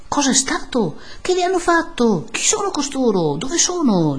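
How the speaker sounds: high, urgent crowd questions; shouted questions. A narrator's reading. Villagers to the priest at his window, shouted questions. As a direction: cry out like a clamouring crowd. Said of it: "gli veniva gridato da cinquanta voci".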